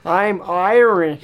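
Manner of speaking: slow, drawn-out fake Irish; Hollywood Irish accent. Co-host mocking American film Irish, slow hammed-up accent. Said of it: "Hollywood Irish accent".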